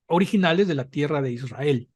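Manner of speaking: ordinary talk, nothing marked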